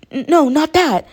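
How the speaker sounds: high and loud, stumbling on the first no; flustered exclamation. A narrator's reading. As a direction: blurt it out in sudden alarm. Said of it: "Uraraka exclaimed".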